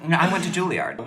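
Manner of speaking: ordinary delivery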